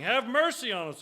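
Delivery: lightly dramatized cry for mercy. A narrator's reading. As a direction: call it out like a reader voicing a plea. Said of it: "cried out, saying".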